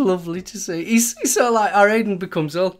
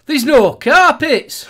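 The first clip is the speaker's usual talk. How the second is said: slow, loud and laughing, higher up; mock shout; 7 semitones higher, 8 dB louder, much slower than usual. Friend retelling the carpet joke, loud slow laughing shout. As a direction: bellow it out slowly through a laugh. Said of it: "Chuck Meister that just made me chuckle".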